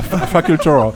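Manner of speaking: "Facultor" said the English way, amused; English pronunciation. Real, not acted. Host retries the donor's name, "Facultor" in English pronunciation. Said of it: "obligé d'être en anglais".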